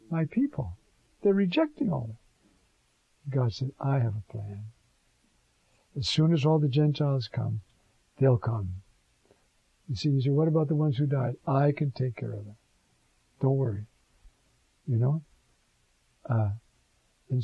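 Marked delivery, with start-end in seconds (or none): none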